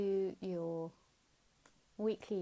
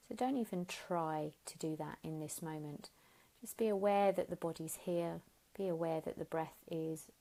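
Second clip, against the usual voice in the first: guided meditation. Acted. Teacher guiding listeners lying down, gentle guided meditation. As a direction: ease them into stillness, unhurried. Leads a Yoga Nidra relaxation.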